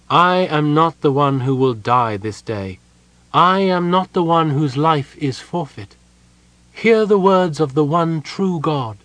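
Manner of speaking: high, loud prophetic declaration; ringing, fervent defiance; defiant proclamation. A narrator's reading. Prisoner proclaims defiantly before executioners.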